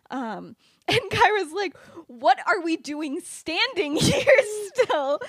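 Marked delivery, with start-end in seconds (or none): 2.1-5.2 high, loud, drawn-out wail with laughter; hammed-up panicked urging, half laughing; mock frantic. Kyra urging friends to move, mock-frantic wail.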